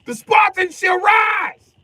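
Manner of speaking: high, loud shouted proclamation; mock battle cry. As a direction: cry it like a movie warrior.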